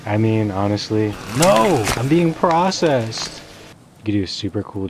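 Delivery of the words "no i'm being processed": loud, high cry of alarm; mock-panicked yell. Real, not acted. Player carried off by the conveyor belt, yelling in alarm. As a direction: yell it out, higher and drawn out.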